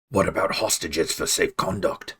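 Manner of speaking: plain reading voice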